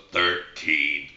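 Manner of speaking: a low, slow little frog calling out the club; talking frog. Acted. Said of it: "frog goes".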